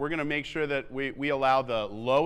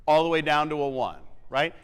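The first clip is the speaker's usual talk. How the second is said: ordinary talk, nothing marked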